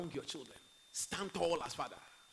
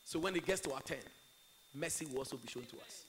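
ordinary delivery

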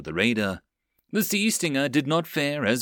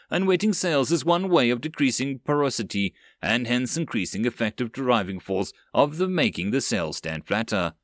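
plain reading voice

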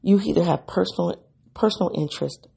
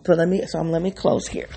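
normal talking voice

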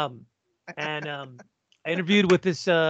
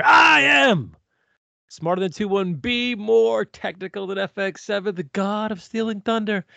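loud hyped promo voice; wrestling promo; 5 semitones higher, 4 dB louder than usual. Acted. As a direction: hype yourself up like a wrestler. Wrestling-style self introduction.

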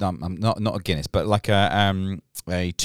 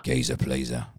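northerner copying London; 8 semitones lower, 5 dB quieter than usual. Retold or imitated. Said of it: "'cause I'm a northerner".

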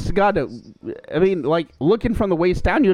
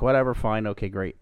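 ordinary talk

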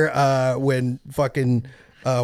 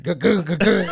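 higher-pitched stuttered crunching noise; car crunching through ditch; 6 semitones higher, 3 dB louder than usual. Retold or imitated. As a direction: sputter like a car bottoming out. Imitates car hitting the ditch.

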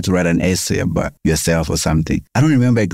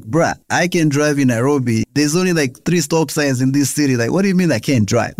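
exasperated comeback; 8 semitones higher than usual. Retold or imitated. Retold comeback to friends.

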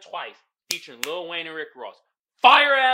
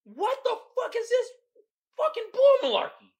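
much higher and louder, squealing mock outrage; outraged disbelief; 7 dB louder than usual. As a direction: shriek it like you can't believe this song. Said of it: "I was like".

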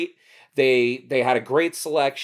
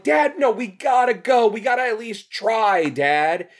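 put-on high, insistent child begging; kid pleading; 8 semitones higher than usual. A boy insisting his dad try the video store, high pleading. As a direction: plead with Dad, refusing to give up.

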